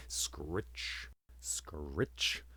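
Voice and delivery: hushed, rasping scrape of an axe on stone; whispered axe-sharpening sound; whispered scritch. Acted. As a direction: breathe it like a blade being sharpened. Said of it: "the sound of an axe being sharpened".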